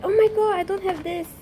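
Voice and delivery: high, thrilled outburst; delighted exclamation at a lucky find; excited surprise. Real, not acted. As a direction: burst out high and loud, then ease off. Thrilled by a card not owned.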